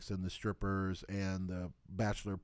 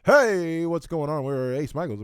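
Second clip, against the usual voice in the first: announcer shout; 5 semitones higher, 10 dB louder than usual. Shown as a demonstration. Repeats the show intro loudly.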